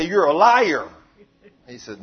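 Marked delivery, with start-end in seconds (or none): 0.0-0.9 loud retort to the devil; acted accusation. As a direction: throw the accusation back, much louder.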